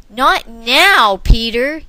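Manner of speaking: loud snapping yell. Acted. Father yelling at his son to go away. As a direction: shout him down. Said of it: "yelled Mister Larson".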